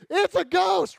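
high, loud alarmed yell; panicked cry. Acted. Disciples in the storm screaming at a figure on water, loud and high. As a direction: yell it out in fright.

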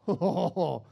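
dopey adoration. Retold or imitated. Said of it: "and you went".

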